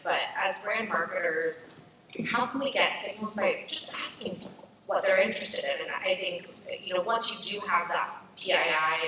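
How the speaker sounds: ordinary delivery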